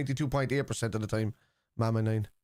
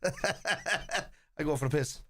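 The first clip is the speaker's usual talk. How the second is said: laughing; 4 semitones higher, much slower than usual. Real, not acted. Laughs at his own joke.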